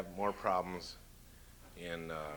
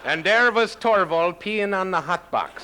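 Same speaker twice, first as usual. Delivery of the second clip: higher, louder, slow mock Swedish punchline; Swedish accent; 10 semitones higher, 13 dB louder than usual. Comic giving a joke's punchline in mock Swedish accent. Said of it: "That's a punchline from a joke".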